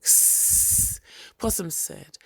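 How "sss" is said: long unvoiced snake hiss. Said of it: "Snake giggled".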